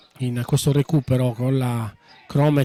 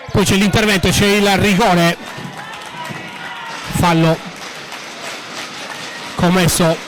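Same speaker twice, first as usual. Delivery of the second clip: excited commentary. Real, not acted. Penalty given, commentator calls it.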